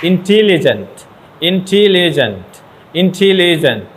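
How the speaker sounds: teacher sounding out "ইন্টেলিজেন্ট" beat by beat; syllable-by-syllable demonstration. Shown as a demonstration. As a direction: say "ইন্টেলিজেন্ট" broken into its syllables, each one drawn out. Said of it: "উচ্চারণ যদি সিলেবলটা ভেঙে যায়".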